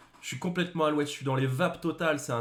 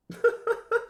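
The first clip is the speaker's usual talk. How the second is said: high laugh; 3 dB louder than usual. Real, not acted. Laughs at own bad joke.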